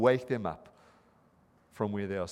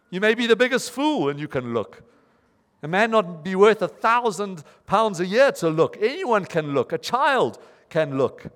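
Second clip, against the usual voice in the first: emphatic preaching; 6 semitones higher, 6 dB louder than usual. Retold or imitated. Preacher hammering his point.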